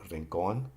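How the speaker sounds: rincón stressed on the final CÓN. Shown as a demonstration. Teacher showing written accent marks, CÓN stressed in rincón. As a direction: land on the last syllable of rincón. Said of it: "accent is on the last syllable".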